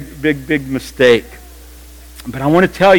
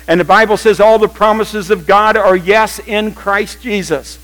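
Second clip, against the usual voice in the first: usual speaking voice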